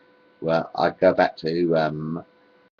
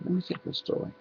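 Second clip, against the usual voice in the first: whispered aside; 10 semitones higher, 11 dB quieter, faster than usual. Real, not acted. Muttering to himself.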